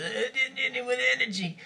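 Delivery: high, tight, strangled rap voice. Shown as a demonstration. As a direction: squeeze the voice like being strangled. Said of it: "It was kinda like this".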